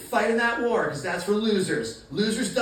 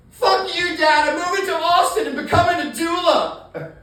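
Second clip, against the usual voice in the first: put-on bratty teen yell; 8 semitones higher, 6 dB louder than usual. Teen yelling at his father.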